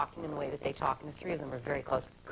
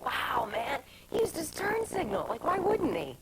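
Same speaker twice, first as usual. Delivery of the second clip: a mock-amazed friend, pitched much higher; 9 semitones higher, slower than usual. Guest imitates the amazed friend reacting to the turn signal, pitched high. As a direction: imitate a friend gushing over nothing, voice up high. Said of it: "He's like".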